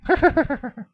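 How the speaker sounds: a high burst of teasing laughter; high cackle. Acted. Husband laughing at his jab about heaven, high teasing cackle. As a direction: laugh at your own joke.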